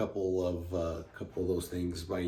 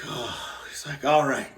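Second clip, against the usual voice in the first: pained groan; 7 semitones higher, 4 dB louder than usual. Acted. Kicked hunter gets up after agony.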